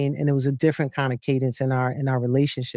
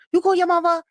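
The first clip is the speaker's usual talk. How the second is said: friend nagging; 4 dB louder than usual. Retold or imitated. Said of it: "my girl Gigi's house".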